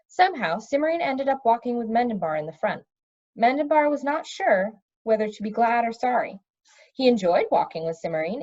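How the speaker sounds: ordinary delivery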